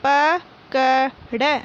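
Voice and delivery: पकड़ drawn out very slowly as प-क-ड़; slow syllable reading. Shown as a demonstration. Teacher reading पकड़ aloud for pupils, very slowly. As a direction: spell out पकड़ aloud, one syllable at a time. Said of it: "मौखिक बोलकर पढ़िए".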